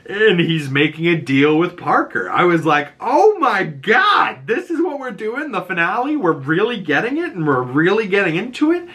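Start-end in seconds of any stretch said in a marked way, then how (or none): 3.0-8.9 excited disbelief. Retold or imitated. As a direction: cry out in thrilled amazement, voice soaring. Acting own excited reaction to reveal.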